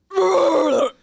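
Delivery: loud hacking throat clear; throat clearing imitation. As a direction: hack loudly to clear throat. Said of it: "You're like".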